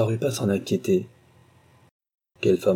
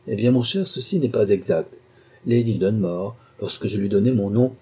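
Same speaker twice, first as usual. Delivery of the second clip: ordinary delivery, nothing marked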